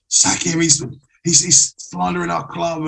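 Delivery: mock outrage. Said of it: "supporters on, on the socials are".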